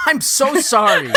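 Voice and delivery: giggling through an apology; high laughing apology. Real, not acted. Laughing fit after joke.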